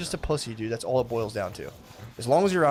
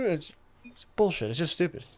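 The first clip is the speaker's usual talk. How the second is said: ordinary talk, nothing marked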